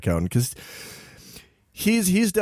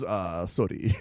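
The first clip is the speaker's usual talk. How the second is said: softer, lower mock-sheepish "sorry"; sheepish apology; 7 semitones lower, 6 dB quieter, much slower than usual. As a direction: imitate a shamefaced mumble. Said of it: "sheepishly hands it to me and goes".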